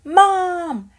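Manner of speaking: loud, high yell for mom. Acted. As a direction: shout across the crowded mall. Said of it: "I yelled".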